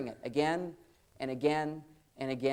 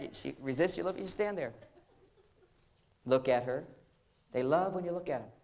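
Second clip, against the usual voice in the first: ordinary talk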